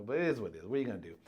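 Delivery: normal talking voice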